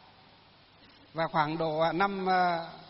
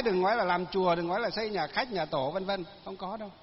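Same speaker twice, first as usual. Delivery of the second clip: normal talking voice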